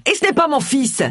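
indignant, high-pitched correction; angry roar. A narrator's reading. Agatha denies being Roy's mother, roaring indignantly. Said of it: "rugit Agatha".

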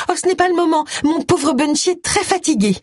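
very high, doting voice; high-pitched, protective fussing; fussing wife. Acted. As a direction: brush the visitor off in a high, cooing pitch. Wife shoos visitor away from husband.

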